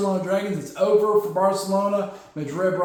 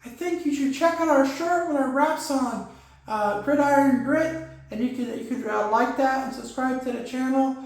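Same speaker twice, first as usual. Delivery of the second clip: a higher put-on sidekick voice; 5 semitones higher than usual. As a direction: answer as Wolf P D in a higher, different voice. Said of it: "what do you think, Wolf P D?".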